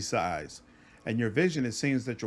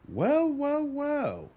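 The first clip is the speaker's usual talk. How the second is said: high mocking; 10 semitones higher, much slower than usual. Mocking reaction to Darren.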